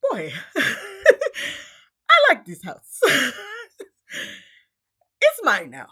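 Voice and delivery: slow, high mock Coming to America accent, laughing through it; hammed-up African prince accent from Coming to America, breaking into laughs. Intruder claiming her house, in a laughing mock Coming to America accent. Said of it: "coming to America accent".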